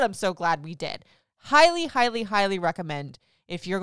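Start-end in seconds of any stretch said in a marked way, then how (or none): 1.4-1.9 emphatic. Real, not acted. Emphatic recommendation.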